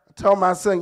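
ordinary talk, nothing marked

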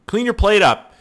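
playful fake anger at a messy eater; mock scolding. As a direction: scold her like a parent, in jest. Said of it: "I'm just kidding".

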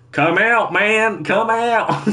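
high-pitched mock German accent. Kidnapper calling badgers out of hiding, mock German accent. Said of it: "saying in a German accent".

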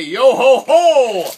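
slow, loud, laughing pirate "Yo ho ho"; pirate cheer. Acted. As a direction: belt it out like a jolly pirate. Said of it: "whoever wins will be able to say".

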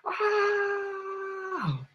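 high hurt yelp; wolf's yelp. Acted. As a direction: yelp in pain. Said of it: "And he's going".

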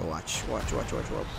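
low, hushed whisper; breathy sneaking whisper, almost no voice. Real, not acted. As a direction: whisper so they won't hear you coming. Sneaking up for a kill.

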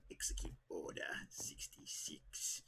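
low raspy whispered order; Palpatine whisper. Acted. Gamer redoing the voice on request, low whisper. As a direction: hiss it like a dark lord. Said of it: "Do it again?".

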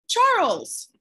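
high, loud alarmed call; alarmed cry. Acted. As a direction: snap his name out in shock. Sister cries out in alarm.

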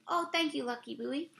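usual speaking voice

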